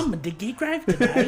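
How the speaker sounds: cartoonish gravedigger Brit; silly British accent. Shown as a demonstration. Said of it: "I can't do nothing like that".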